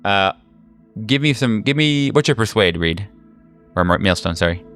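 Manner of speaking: usual speaking voice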